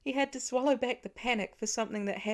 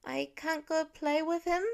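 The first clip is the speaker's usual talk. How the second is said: put-on higher, small child's plea; young boy, disappointed; 7 semitones higher than usual. A narrator's reading. Little Nate asks his dad, a childlike plea. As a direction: ask it like a let-down little boy.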